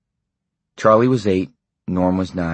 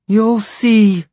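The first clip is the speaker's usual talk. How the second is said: much higher, tearful warning; through tears; 6 dB louder than usual. A narrator's reading. Wife warning her husband through her tears, tearful. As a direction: warn him while weeping. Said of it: "she said through her tears".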